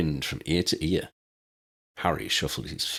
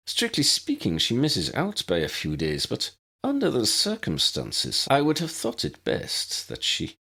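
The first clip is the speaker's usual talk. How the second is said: high, prim Scottish headmistress voice; brisk, proper elderly professor; McGonagall; 6 semitones higher than usual. A narrator's reading.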